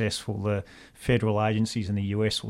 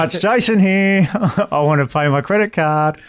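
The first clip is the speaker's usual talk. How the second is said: higher and louder, with a laugh; cheery caller; 8 semitones higher, 10 dB louder than usual. Pretend. Jason calling his bank from the car, high and loud. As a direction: beam it down the phone line.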